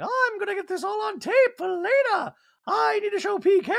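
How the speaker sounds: very high falsetto put-on voice; Dorit. Dorit recording the show for later, high mock voice. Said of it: "Dorit is still recording, like".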